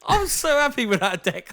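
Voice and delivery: high, loud thrilled telling; so happy. Real, not acted. Boxer telling his podcast host about meeting Canelo, thrilled. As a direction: burst with delight as you tell it. Excited retelling.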